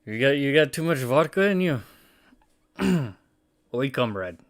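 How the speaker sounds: mock Russian accent, badly done; horrible Russian accent. Teasing Alex about vodka, in a bad Russian accent. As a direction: put on a terrible Russian accent. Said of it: "That was probably a very horrible, horrible accent".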